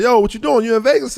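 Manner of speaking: high, loud hyped-up call; excited greeting. Retold or imitated. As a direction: greet him like an old friend. Friend calling on the phone.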